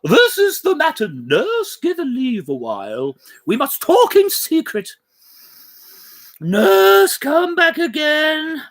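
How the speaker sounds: a hint of a stately mother giving orders; measured lady of the house, dismissing then recalling; noble lady. Lady Capulet addresses the nurse.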